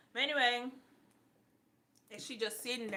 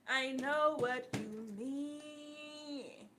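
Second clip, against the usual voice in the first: slow drawn-out; 3 semitones higher, much slower than usual. Real, not acted. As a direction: stretch each word out slowly.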